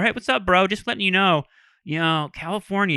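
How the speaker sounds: high, casual bro voice, easing off; bro landlord. Retold or imitated. Landlord at the door to tenant, high breezy bro voice. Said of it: "That's how he talks".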